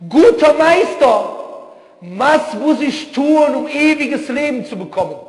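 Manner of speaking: high, slow and loud, an eager seeker begging the master; pleading questioner. Retold or imitated. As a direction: ask it up high and drawn out, like a humble petitioner.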